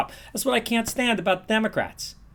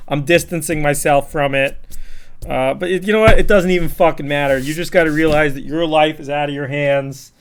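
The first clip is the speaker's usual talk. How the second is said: ordinary delivery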